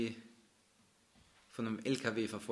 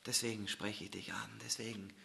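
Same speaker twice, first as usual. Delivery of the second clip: whisper; 10 dB quieter than usual. Acted. Preacher whispers God's truth to the listener, whisper. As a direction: whisper it close, unvoiced.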